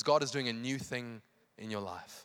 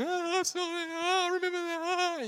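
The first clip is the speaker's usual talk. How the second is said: very high, sustained comic wail; hammed-up heartbroken whine over memories; mock wailing; slower than usual. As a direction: wail it on one high held note. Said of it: "You start going".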